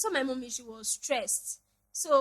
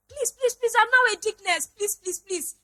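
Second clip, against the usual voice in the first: mother's higher, pleading voice; imitating mom pleading; 9 semitones higher, 9 dB louder than usual. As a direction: plead like a mom insisting she's innocent. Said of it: "That was how my mommy did".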